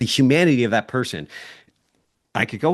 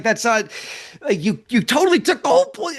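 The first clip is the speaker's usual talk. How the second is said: exasperated interruption; 12 semitones higher than usual. Retold or imitated. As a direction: cut them off mid-sentence in disbelief. Retold argument, exasperated retort.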